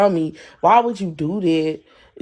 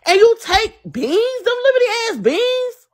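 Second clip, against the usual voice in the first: high-pitched, incensed outburst; furious high-voiced demand; real outraged yelling; 13 semitones higher than usual. Real, not acted. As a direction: shriek it in disbelief at being shortchanged. Angry rant over money owed.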